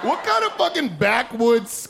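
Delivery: normal talking voice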